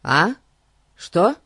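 high, loud startled questions; distracted, caught off guard. A narrator's reading. Jolted out of deep thought.

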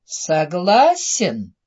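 very slow, drawn-out question; coaxing evil fairy. A narrator's reading. Evil fairy asks the prince to agree, slyly coaxing. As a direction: stretch it temptingly, letting the pitch swoop.

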